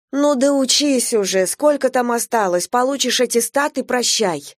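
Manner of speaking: lightly voiced mother begging; pleading. A narrator's reading. Mother pleading with her daughter to finish school, lightly pleading. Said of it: "взмолилась мама".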